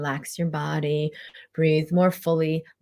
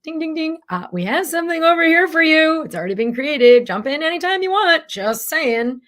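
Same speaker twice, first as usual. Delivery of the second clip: much higher, louder, sing-song announcer chime; cheery life voice; 11 semitones higher, 8 dB louder than usual. Acted. Life calling out to her, high, loud and chirpy. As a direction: play life as a chirpy game-show host.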